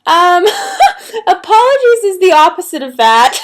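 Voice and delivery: high, loud, giggling through the words; laughing correction. Retold or imitated. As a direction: correct her while cracking up. Laughing through her retold correction.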